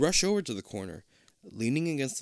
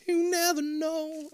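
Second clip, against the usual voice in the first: spooky voice; slower than usual. Pretend. Echoes the spooky line.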